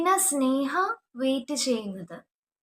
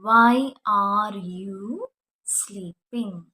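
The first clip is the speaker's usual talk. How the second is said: slow model sentence; 3 semitones lower, much slower than usual. Shown as a demonstration. Teacher modelling the question for students, very slow. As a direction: stretch every word for the class. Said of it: "നീ എന്തിനാ ഉറങ്ങുന്നത്?".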